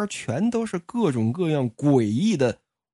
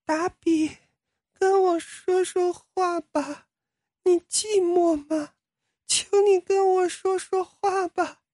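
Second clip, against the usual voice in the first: very high, slow and pleading; creepy doll voice; slower than usual. Acted. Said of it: "所有的娃娃都在说话".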